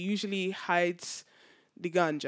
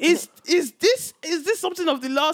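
stern policeman; 8 semitones higher, 5 dB louder than usual. Retold or imitated. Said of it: "He said".

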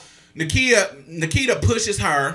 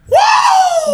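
very high, loud "woo"; excited whoop; 6 dB louder than usual. Real, not acted. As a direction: let out a piercing hyped whoop. Hyped cry at thought of fighting.

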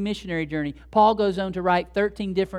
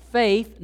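"faith" set apart, higher and louder; stress on faith; 4 semitones higher, 6 dB louder than usual. Acted. Reading Paul to the church, "faith" punched high and loud. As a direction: hit "faith" hard after a short pause. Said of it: "he always stresses that".

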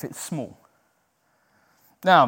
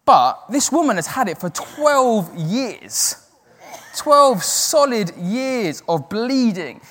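slow, raised, weighty emphasis on the years; 6 semitones higher, 4 dB louder, much slower than usual. Real, not acted. Preacher stresses the length of her suffering, slow and weighty. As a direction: lean hard into every word, slowly.

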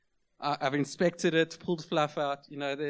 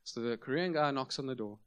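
normal talking voice

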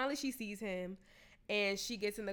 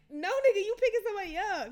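raised, higher, laughing-edged protest; exasperated retort; 11 semitones higher, 8 dB louder than usual. Retold or imitated. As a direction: push back loud and incredulous. Annoyed rider corrects confused driver.

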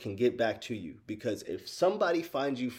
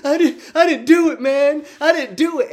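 high, loud mock wail of a caught kid; imitating crying; 12 dB louder than usual. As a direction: overdo a sobbing plea of innocence, pitched way up. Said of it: "this dude just starts crying".

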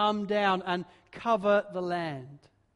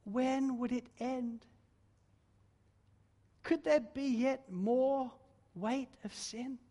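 hushed, slow questions; 4 semitones higher, 5 dB quieter, slower than usual. Acted. Dramatic questions in a quotation.

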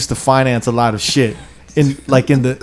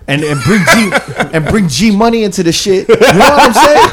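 laughing through it; 7 semitones higher than usual. Real, not acted. Laughs while retelling the call.

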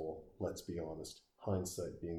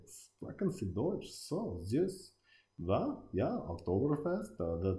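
put-on horrible German accent; 3 semitones higher than usual. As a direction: do a bad German accent with swooping pitch. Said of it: "horrible German accent".

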